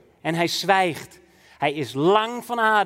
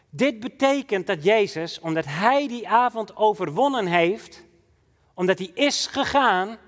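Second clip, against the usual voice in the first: ordinary talk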